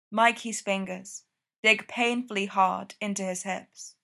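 ordinary delivery

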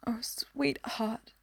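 breathy hushed comfort; tender slow whisper to a crying girl; soft whisper. A narrator's reading. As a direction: whisper it slowly to soothe her.

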